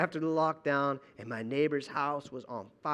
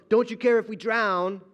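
panicked plea; 5 semitones higher, 7 dB louder than usual. Retold or imitated. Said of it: "They shift from".

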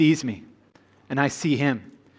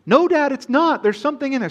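daughter calling out; 10 semitones higher than usual. Retold or imitated. Daughter calling Dad from her bed, insistent high call. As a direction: pitch it up like his daughter pleading.

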